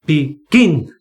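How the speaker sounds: slow down and land hard on the gin of begin; stress on final syllable. Shown as a demonstration. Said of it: "stress is on the final syllable".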